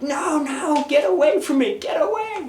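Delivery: high, frantic cry to get away; panicked shriek. Retold or imitated. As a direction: squeal in terror like someone being chased. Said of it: "he's in this position like".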